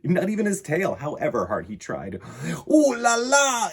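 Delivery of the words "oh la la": high, loud, drawn-out dismay; French exclamation. A narrator's reading. Ringmaster despairing at the gentle lion, loud and high. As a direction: throw up your hands in dismay. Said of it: "exclaimed Monsieur Tambour".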